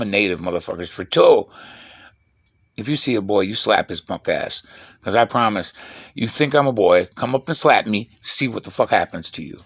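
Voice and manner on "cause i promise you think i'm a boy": usual speaking voice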